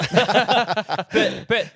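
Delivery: laughing. Real, not acted. Co-host laughing at the guest's [name] quip. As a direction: laugh through the word.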